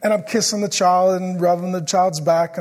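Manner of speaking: ordinary talk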